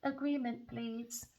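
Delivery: pleading man. Retold or imitated. Reader voicing Leo begging at work, lightly voiced and meek. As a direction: ask for peace in a meek put-on voice. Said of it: "He said".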